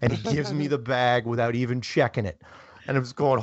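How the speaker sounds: usual speaking voice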